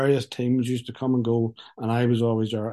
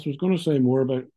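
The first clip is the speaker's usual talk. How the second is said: ordinary talk, nothing marked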